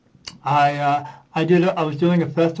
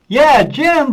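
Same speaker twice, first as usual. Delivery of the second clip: excited yes; 9 semitones higher, 8 dB louder than usual. Retold or imitated. Excited recognition of the name.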